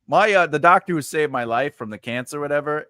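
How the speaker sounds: ordinary delivery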